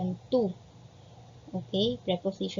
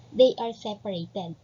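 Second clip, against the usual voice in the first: ordinary delivery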